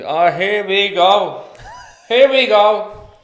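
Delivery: loud, slow mocking dad voice; dad teasing son about money; mock suspicious dad. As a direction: boom it out like a sceptical father.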